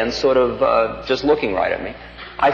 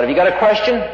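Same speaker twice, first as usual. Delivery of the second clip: shouting the question across the yard; retold yell; 8 semitones higher than usual. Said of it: "he yells out".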